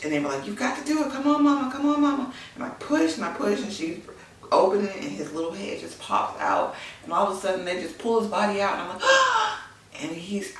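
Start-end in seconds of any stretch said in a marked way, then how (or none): 0.4-2.3 high, pushing cheer of the nurses; encouraging urging. Retold or imitated. Staff urging her to push. 9.1-9.7 very high, loud startled gasp; sharp gasp. Real, not acted. Baby pulled out.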